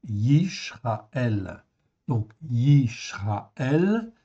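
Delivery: "Yishraël" dit très lentement, avec un "sh"; prononciation lente. Shown as a demonstration. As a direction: articulez "Yishraël" lentement, syllabe par syllabe. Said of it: "je mets mon accent". ("Yishraël" prononcé lentement, avec le son "ch")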